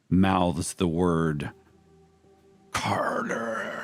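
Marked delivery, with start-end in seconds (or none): 2.7-3.7 gurgled name. Acted. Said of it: "like a Foley sound rather than a voice".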